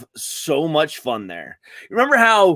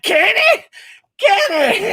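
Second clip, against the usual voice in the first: much higher calling voice, grandma yelling; grandmother calling; 4 dB louder than usual. Retold or imitated. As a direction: call across the house like grandma. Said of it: "she'd be like".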